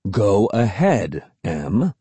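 lightly voiced firm father; insistent dad. A narrator's reading. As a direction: insist slowly, as a parent would. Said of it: "Dad insisted".

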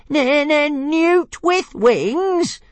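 very high, very slow squeaky dragon voice; outraged stammering dragon. A narrator's reading. Tiny dragon squealing the insult back, very high and drawn out. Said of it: "repeated Toothless".

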